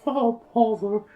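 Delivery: high, gentle cartoon bear voice, half laughing; Winnie the Pooh voice. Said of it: "Is that Jim Cummings?".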